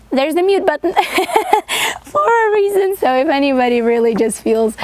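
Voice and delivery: talking through a real laugh, pitched high. Real, not acted. Joking to viewers about the mute button, laughing. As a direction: let the giggle lift the words high.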